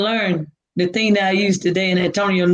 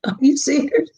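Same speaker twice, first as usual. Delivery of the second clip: shocked disbelief; 6 semitones higher than usual. Retold or imitated. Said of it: "I'm like".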